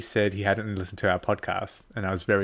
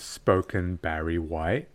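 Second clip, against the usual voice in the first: lower, slow podcasting voice; 5 semitones lower, slower than usual. Acted. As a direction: bring it down and slow it right down.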